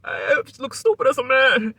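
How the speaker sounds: high, loud mock whine; mock crybaby. Mocking Marty's crybaby spiel in the room, a high mock whine. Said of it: "cry fest".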